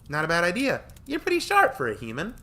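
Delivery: put-on high, loud teasing girl voice; smug backhanded praise; smirking. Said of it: "Tori smirked".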